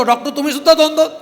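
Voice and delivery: high-pitched, alarmed outburst at a doctor; retold shout. Patient seeing double yells at the eye doctor, shouting. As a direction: cry out loud and high. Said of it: "हा ओरडतो".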